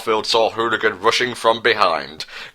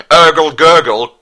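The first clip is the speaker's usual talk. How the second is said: higher, louder gargled drowning noise; gurgle; 6 semitones higher, 8 dB louder than usual. Acted. Ringleader drowns under the beer tap, loud gurgling. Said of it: "gurgled the hooligan ringleader".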